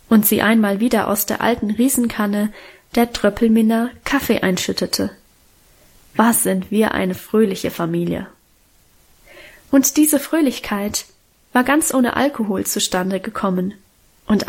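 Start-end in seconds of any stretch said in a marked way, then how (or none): none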